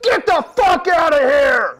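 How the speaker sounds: loud, high, slow mock yell; mock crowd yelling back; crowd shout. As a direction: shout him out of the room. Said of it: "Everybody in the room would be like".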